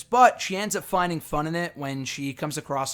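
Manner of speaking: ordinary delivery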